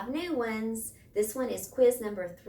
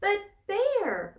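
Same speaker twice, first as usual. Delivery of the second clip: much higher, louder squeaky mouse voice; little mouse crying out; 12 semitones higher, 8 dB louder than usual. A narrator's reading. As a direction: cry out like a tiny worried mouse. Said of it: "Mouse cried".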